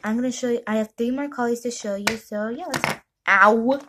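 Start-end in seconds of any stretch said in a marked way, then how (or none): 3.3-3.8 sharp yelp of pain; sudden pain. Real, not acted. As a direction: cry out from a bump. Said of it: "Ow, my head".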